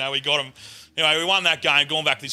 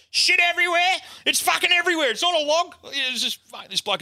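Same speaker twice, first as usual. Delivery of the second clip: outraged publican. Retold or imitated. Publican ringing back about the soiled bus seat, high outraged rant. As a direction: rant in disbelief at the mess.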